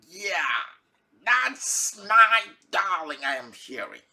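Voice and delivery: a touch of mock delight; lightly acted glad husband. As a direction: play a husband pleased to hear his darling. Husband glad to hear his darling.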